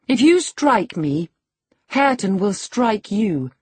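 high, deliberate dare; defiant warning. A narrator's reading. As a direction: face him down in a high, firm voice. She dares him to strike.

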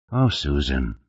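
mildly put-on tiredness; exaggerated fatigue. A narrator's reading. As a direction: sag into it as if worn out. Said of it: "exaggerating his fatigue".

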